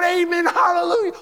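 very high, loud shout of praise; exultant shout. Acted. Redeemed praising in heaven, exultant shout. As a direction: shout it at the top of the voice. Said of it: "We're gonna shout".

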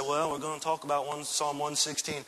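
mock a pastor with no enthusiasm; a droning preacher without spark; mock dull pastor. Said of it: "How would you like it if the pastor of your church just said".

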